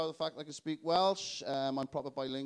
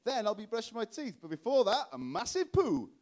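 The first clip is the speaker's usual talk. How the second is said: much higher, chirpy presenter voice; kids' TV presenter voice; 5 semitones higher, 3 dB louder than usual. Retold or imitated. Ex-presenter waking up alone, bright chirpy presenter voice. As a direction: beam like a children's TV host. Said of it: "wake up and say".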